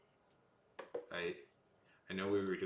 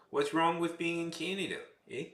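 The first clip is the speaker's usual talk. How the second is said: mock Canadian; 8 semitones higher, 4 dB louder than usual. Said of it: "uptick at the end of their voice".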